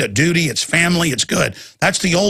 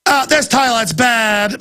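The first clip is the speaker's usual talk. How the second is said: slow, high mock Australian voice; put-on Australian accent, pitched up; 7 semitones higher, slower than usual. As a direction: parody an Australian TV inspector, drawn out. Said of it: "it's with an Australian voice".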